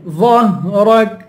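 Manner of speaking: ظهرك slowly with a full, heavy ظ; thickened first sound. Shown as a demonstration. Teacher showing heavy letters, ظهرك slow and full-mouthed. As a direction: chant ظهرك with a thick opening. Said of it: "موٹا کر کے ادا کریں گے".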